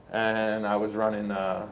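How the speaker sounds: usual speaking voice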